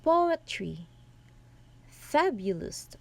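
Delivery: POetry, FAbulist, high first syllable and two falling away; first-syllable stress. Shown as a demonstration. Presenter demonstrating dactyls, "Poetry, fabulist" stressed on the first syllable. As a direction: punch the opening of "Poetry" and "fabulist", let the rest drop. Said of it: "the stressed are in the first syllables".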